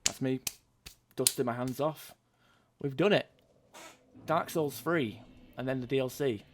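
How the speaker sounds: high, pleased sign-off after a long haul; real triumph. Real, not acted. Celebrates finishing the whole series.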